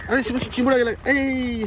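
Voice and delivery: real high-pitched alarmed cry; urgent call-out. Real, not acted. Warning a friend the crab got away, urgent high call-out. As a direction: call out urgently, voice leaping high, drawn-out at the end. Warns Suresh of escaping crab.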